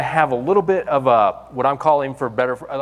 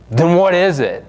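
louder, higher, baffled demand; exasperated outcry; 5 semitones higher, 6 dB louder than usual. Retold or imitated. Pastor retelling his call, demanding an answer from God, loud and baffled. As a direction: throw the question back in disbelief. Protesting to God in retold story.

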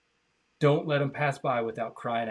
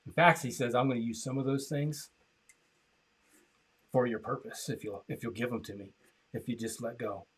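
softer, lower, tender turn; 4 semitones lower, 8 dB quieter than usual. Acted. Preacher gently tells listeners God still loves them, softly. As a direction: drop to a soft, tender promise.

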